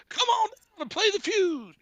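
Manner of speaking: very high mock host call; game show slogan. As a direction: taunt with a high-pitched TV catchphrase. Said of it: "he would just say".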